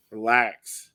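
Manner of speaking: ordinary talk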